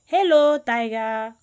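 loud, high, teasing Anansi hello; smug spider greeting. Acted. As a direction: call down sweetly to the trapped tiger. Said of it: "looked down and smiled".

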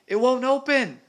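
very high, loud panicked cry; shouting. A narrator's reading. Peter fumbling with the locket, shouting. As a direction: shout in desperation for help. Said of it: "he shouted".

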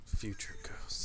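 slow dramatic whisper. Acted. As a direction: breathe it like a game trailer title.

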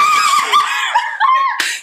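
loud, very high cackle; disgusting laugh. Real, not acted. Daughter teased about her room changes, loud ugly laugh. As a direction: burst out in a shrieking, unlovely laugh. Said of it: "That was a disgusting laugh".

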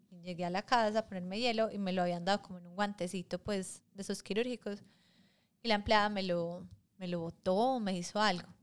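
ordinary talk

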